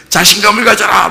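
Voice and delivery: high-pitched pep-talk call; hammed-up rallying call; mock exhortation. World urging people, acted quote.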